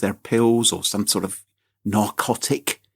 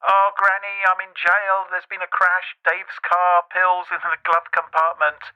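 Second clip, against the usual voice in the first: put-on higher, pleading young caller; imitating the caller; 6 semitones higher than usual. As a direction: play the scammer begging granny for help. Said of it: "the person on the line said".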